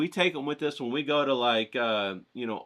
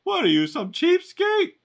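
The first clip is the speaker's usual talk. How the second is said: very high, slow, louder sneering salesman; snooty dealer sneer; 7 dB louder than usual. Acted. Art dealer mocking the buyer as cheap, high sneering. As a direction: scoff at him in a shrill high put-on voice.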